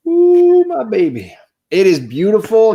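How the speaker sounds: high, loud "ooh" sliding down; delighted high-pitched swoon; gleeful gushing. Real, not acted. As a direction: coo loudly over a treasured find. Showing off his prized comic.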